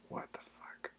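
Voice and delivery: breathless, unvoiced under-the-breath swear; a real exasperated whisper; whispered curse. Real, not acted. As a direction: mutter it to yourself in disbelief. Frustrated muttering while debugging code.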